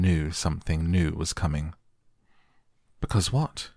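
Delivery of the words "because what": high eager question; pleading eagerly for the answer; eager cry. A narrator's reading. Boy begging Mary to finish, eager. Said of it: "he cried eagerly".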